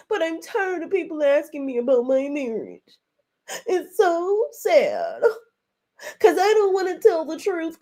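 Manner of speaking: hammed-up whimpering, as if turning on the waterworks; mock tearful. Tisha pleading about questions on her marriage, in exaggerated tearful whine. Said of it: "she put the tears on".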